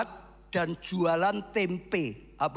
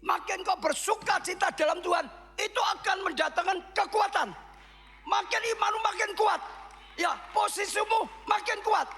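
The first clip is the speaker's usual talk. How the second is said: preaching at full force; 12 semitones higher than usual. Acted. Pastor shouts encouragement to the congregation, full fervour. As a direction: preach it out with fire.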